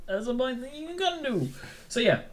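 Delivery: squeaky-high mock aside; silly high voice. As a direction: say it way up high, playfully. Silly voice on donation plug.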